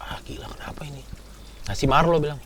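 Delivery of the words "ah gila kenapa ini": panicked unvoiced whisper; bisik panik. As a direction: hiss it out in fear, breathless. (bisikan panik ketakutan)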